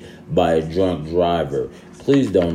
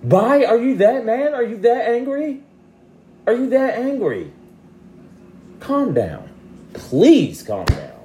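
much higher, exasperated sassy voice; high mock exasperation; 13 semitones higher than usual. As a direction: scold them in a high put-on voice. High mocking retort to commenter.

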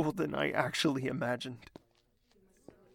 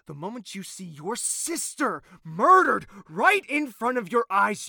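angry; 5 semitones higher, 9 dB louder than usual. Acted. Grief-fuelled anger.

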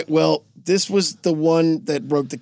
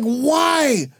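high-pitched pleading wail; mock dismay; 7 semitones higher than usual. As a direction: plead like a betrayed teammate.

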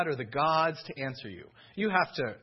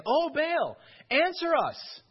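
high, loud pleading call; desperate cry to Baal; 10 semitones higher, 4 dB louder, slower than usual. Acted. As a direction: cry out to a silent god. Prophets crying out to Baal.

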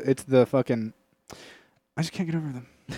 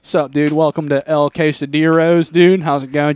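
louder laid-back surfer dude greeting; surfer waiter; 2 semitones higher, 8 dB louder than usual. Retold or imitated. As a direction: hype up a blond surfer waiter welcoming guests. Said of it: "he's like".

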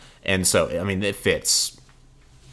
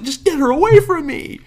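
very high, raised, exasperated voice; retold exasperated plea; 5 dB louder than usual. Pleading with her friends to remove her, high exasperated plea. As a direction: re-enact begging to be rescued.

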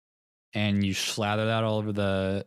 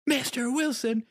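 shrill mock argument; 8 semitones higher, slower than usual. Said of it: "they're just sitting there arguing".

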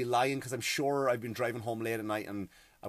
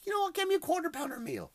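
quoting his own phone order, much higher; the order to his wife, pitched up high; quoting himself. Retold or imitated.

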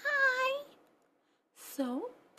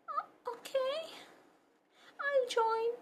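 usual speaking voice

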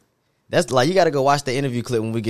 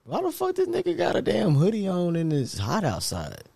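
mocking onlooker voice; 9 semitones higher, 3 dB quieter than usual. Voicing people judging a hoodie.

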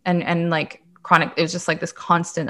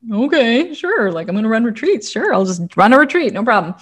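much higher, mock-breezy sarcasm; sarcastic agreement; 5 semitones higher, 4 dB louder than usual. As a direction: brush it off sarcastically, pitched way up. Answering the universe's instruction.

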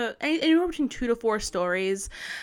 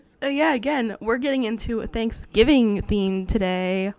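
usual speaking voice

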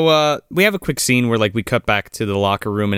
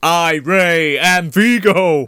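slow, higher, possessed proclamation; possessed Ray; 7 semitones higher, 4 dB louder, much slower than usual. Retold or imitated. Ray possessed by Vigo, slow proclamation. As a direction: announce it like a demon took your body. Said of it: "when he gets Vigo-fied".

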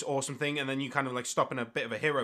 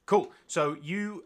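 ordinary talk, nothing marked

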